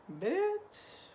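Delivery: high squeal of delight; excited squeal. Real, not acted. Showing viewers the sewn shirt, high excited squeal. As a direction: squeal it, thrilled.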